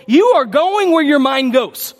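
ordinary talk

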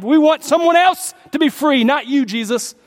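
high, loud jeering crowd cry; crowd shouting. Retold or imitated. Preacher voicing the crowd rejecting Jesus, loud high shout. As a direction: bellow it like a mob demanding a prisoner. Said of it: "As the people proclaimed".

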